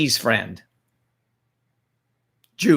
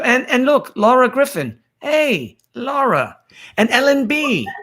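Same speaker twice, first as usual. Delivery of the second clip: high-pitched, excited hello; excited greeting; 9 semitones higher than usual. Real, not acted. Host spots Laura and Ellen joining, excited greeting. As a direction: greet arrivals in a high, sing-out voice. Greeting viewers who join the stream.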